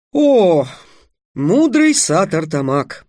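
loud opening, slow reverent address; courtly bow. A narrator's reading. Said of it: "Трикс поклонился". (торжественное почтительное обращение)

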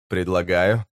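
plain reading voice

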